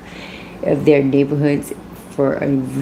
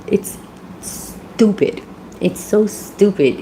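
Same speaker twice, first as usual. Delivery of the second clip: exasperated; 5 semitones higher, much slower than usual. Real, not acted. Exasperated at suspects' stupidity.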